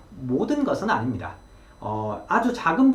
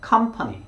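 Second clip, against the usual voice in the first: company with the stress on the first syllable, com, said higher; 5 semitones higher, much slower than usual. Shown as a demonstration. Teacher models company with the first syllable stressed. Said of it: "제일 앞에 음절에 이렇게 강세 표시".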